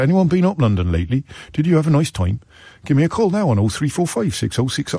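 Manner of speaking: bad Norfolk local-radio presenter accent; lilting, badly faked Norfolk phone-in host; botched Norfolk accent. Local DJ asking listeners about London, botched Norfolk accent. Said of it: "I don't know what that accent is".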